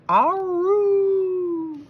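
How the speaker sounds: high, long hound howl; howling hound dog. A narrator's reading. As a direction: throw back your head and howl like a basset. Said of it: "lifted his muzzle and hollered".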